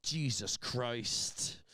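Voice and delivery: slow, drawn-out disgusted oath; mock appalled trooper, dragging out each word. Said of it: "He was disgusted".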